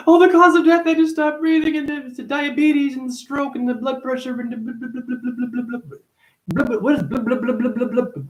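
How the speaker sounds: very high, droning mock voice; mocking know-it-all. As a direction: babble it high, dissolving into blahs. Mocks others explaining causes of death.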